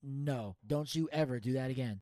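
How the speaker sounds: low, quiet scolding; stern disapproval. Real, not acted. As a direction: shut it down in a low, soft voice. Scolding co-host for the catchphrase.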